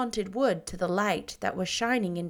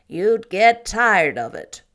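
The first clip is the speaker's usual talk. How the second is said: put-on louder, slow, tired old woman; Marilla, weary; 11 dB louder, slower than usual. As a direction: sigh it out as stern Marilla. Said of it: "with a sigh".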